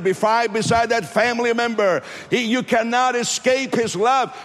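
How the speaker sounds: impassioned pulpit declaration; full-force preaching. Real, not acted. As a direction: preach it high and urgent, driving every phrase home.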